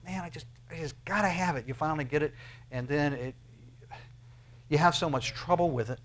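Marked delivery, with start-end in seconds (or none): 0.0-1.7 mock craving. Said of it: "and you go".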